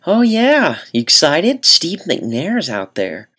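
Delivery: put-on chirpy nurse teasing a kid; nurse lady. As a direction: orthodontist nurse chatting with an excited boy. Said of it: "the orthodontist nurse lady overheard me".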